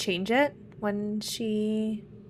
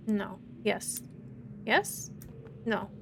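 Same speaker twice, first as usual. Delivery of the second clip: ordinary delivery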